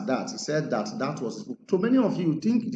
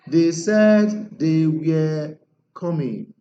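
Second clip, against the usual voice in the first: 1 slow model sentence; 6 dB louder, much slower than usual. Shown as a demonstration. Teacher models reported speech slowly.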